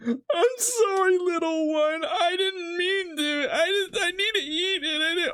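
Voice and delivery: very high, slow, teary apology; hammed-up sobbing sorry to the onion; mock crying. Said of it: "I'm actually making myself cry".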